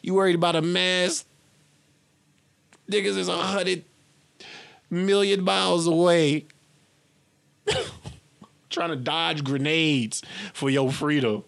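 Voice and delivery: high, loud disbelieving rant; rising outrage, half laughing; loud high incredulity; incredulous rant. Real, not acted. Incredulous rant about troops.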